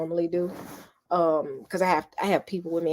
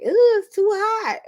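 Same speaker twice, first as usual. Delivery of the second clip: mock daughter; 6 dB louder than usual. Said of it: "is like".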